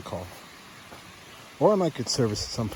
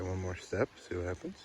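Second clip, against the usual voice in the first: ordinary talk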